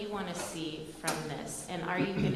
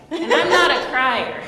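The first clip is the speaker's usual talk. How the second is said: loud laughing near tears; emotional laughing; holding back tears; 10 semitones higher, 18 dB louder than usual. Real, not acted. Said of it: "try not to cry".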